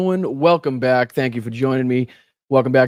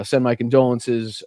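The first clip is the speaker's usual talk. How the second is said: usual speaking voice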